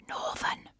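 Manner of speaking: "Northern" whispered as if a dirty word; hushed, voiceless mock shock; mock-scandalised whisper. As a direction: whisper it in pretend horror.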